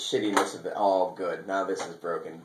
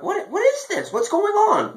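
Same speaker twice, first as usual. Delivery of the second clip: startled; slower than usual. Retold or imitated. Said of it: "And I'm like".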